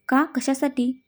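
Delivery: plain reading voice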